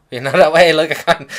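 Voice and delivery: a real laugh breaking into the words; telling the story, laughing at his own awkwardness. Real, not acted.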